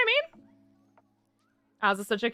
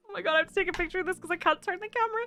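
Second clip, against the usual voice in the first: laughing; 8 semitones higher than usual. Real, not acted. Laughing at cat in scrunchie.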